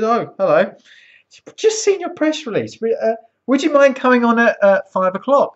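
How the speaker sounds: chirpy caller. Retold or imitated. Imitating the BBC caller.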